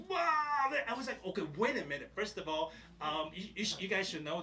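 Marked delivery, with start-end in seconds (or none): 0.1-0.7 high wailing cry, hammed up; high mock wail; mock bawling. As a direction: imitate a sobbing cousin's wail. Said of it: "she started to, to bawl, like".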